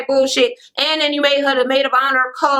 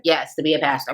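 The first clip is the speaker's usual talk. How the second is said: low flat confirming answer; 8 semitones lower, 4 dB quieter than usual. Retold or imitated. Vlogger mimics a cast member answering, low and flat. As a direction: drop the voice low and deadpan. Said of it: "They was like".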